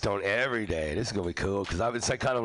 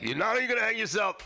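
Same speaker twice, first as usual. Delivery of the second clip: mock executioner; 10 semitones higher than usual. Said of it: "they're like".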